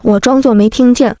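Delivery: ordinary delivery, nothing marked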